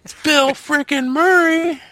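high, drawn-out mock exclamation; mock awe. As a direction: stretch it out way up high, hamming up the awe. Mock outburst about a famous actor.

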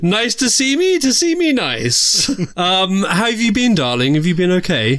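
high, giggly camp diva; campy drag queen. Acted. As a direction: coo at him like a drag diva. Host persona.

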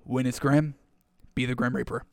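low, hushed words leaned in close; quiet coach aside. Retold or imitated. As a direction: murmur it into the helmet like a calm coach. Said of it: "kinda leans over your helmet and says".

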